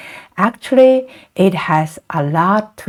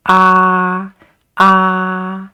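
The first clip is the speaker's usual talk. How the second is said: "ah" held long on one level pitch; a long, flat, steady "ah"; first tone. Shown as a demonstration. Teacher showing learners the flat first tone, level "ah". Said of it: "it's a flat line".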